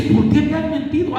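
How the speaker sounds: high, indignant demand; angry Jacob. Acted. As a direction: throw the question out in outrage. Jacob reclamando enojado. (Jacob reclama enojado, voz alta)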